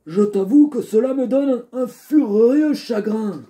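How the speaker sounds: put-on high, aggrieved old man. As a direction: complain like a sorrowful old father. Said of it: "une voix un peu vieux".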